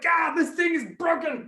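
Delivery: high, frustrated yell; hammed-up frustration; exasperated outburst. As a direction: cry out at a faulty gadget. Neighbour fed up with dreidel.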